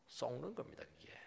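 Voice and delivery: unvoiced whisper of 속는 겁니다. Real, not acted. Preacher whispering that you deceive yourself. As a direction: whisper the warning. Hushed punchline.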